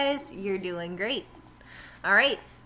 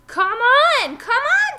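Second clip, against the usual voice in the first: much higher, much louder whining shout; impatient; 12 dB louder, slower than usual. Acted. Terrence pleading with Lewis before recess ends, loud whine. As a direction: beg at full volume, can't wait.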